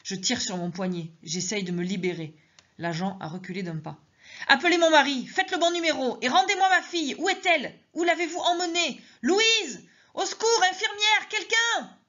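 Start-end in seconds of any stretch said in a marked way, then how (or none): 4.5-12.0 much higher, frantic cries for help; shrill panicked shouting, calling Louise; hysterical screaming. Acted. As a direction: scream for her daughter and for help. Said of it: "je hurle".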